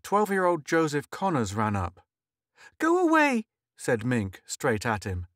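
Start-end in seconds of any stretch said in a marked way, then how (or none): 2.8-3.4 put-on dismissive girl. Girl rejecting older boy.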